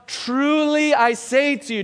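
"Truly" lifted high and pressed hard; stress on Truly. Shown as a demonstration. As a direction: lean into "Truly" as a solemn oath. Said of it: "Do you hear the emphasis there?".

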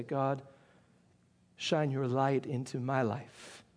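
soft, low voiced prayer; hushed, reverent plea to God; humble prayer. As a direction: pray it quietly and low, as if submitting.

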